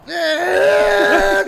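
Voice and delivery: high, loud, stretched mock sob; mock crying wail. Son imitating mom crying on the phone, loud wail. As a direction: wail like the weeping grandmother. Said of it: "crying on the phone".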